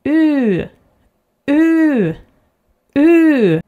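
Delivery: U with small rounded lips, as if to whistle; long Swedish U. Shown as a demonstration. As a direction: round U, lips pushed toward teeth. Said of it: "like that".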